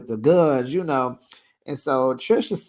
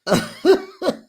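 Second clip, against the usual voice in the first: laughing; 14 semitones higher, 4 dB louder than usual. Real, not acted.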